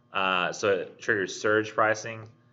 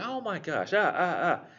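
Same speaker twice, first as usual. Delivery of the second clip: higher-pitched hurt yelps after stubbing a toe; pained outcry; 9 semitones higher than usual. Pretend. Dock worker hurting his hand, pained high yelps. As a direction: cry out in sudden pain, high. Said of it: "he gets pissed".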